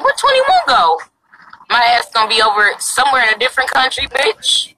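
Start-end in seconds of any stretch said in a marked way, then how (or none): 0.0-1.0 mocking viewers. Mocking the viewers asking where she went, high voice. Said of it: "Y'all gonna be like".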